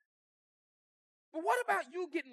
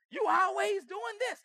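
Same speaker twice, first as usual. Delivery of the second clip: slow, pitched-up shout of blame; spouse accusing; 6 semitones higher, slower than usual. Retold or imitated. Angry spouse shouting at partner, slow and raised. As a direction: bark the complaint at your spouse.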